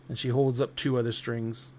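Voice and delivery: usual speaking voice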